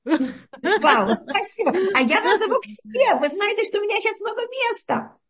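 high, laughing, thrilled; delighted laughing. Real, not acted. Laughing at invitations to visit.

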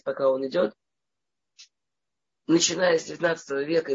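ordinary talk, nothing marked

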